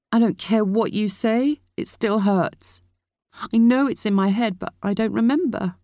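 high-pitched wounded defiance; high, aggrieved teenage retort; hurt protest. A narrator's reading. Hurt niece arguing back.

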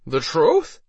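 tipsy mocking question; drunk, laughter fading. A narrator's reading. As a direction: repeat it back like an amused drunk. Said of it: "Harold answered as his laughter died away".

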